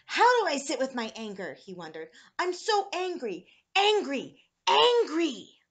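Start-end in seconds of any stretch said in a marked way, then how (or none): 0.1-1.5 loud, higher-pitched frustrated question; angry wondering. A narrator's reading. Boy sent to his room questions himself, angry wondering. 2.4-5.6 loud, high, drawn-out fury; building anger. A narrator's reading. Boy alone on his bed fuming, building anger.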